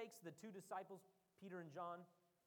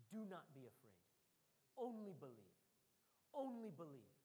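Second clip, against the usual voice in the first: calm reassurance; 4 semitones lower than usual. Acted. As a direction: soothe a frightened father. Jesus speaks to Jairus.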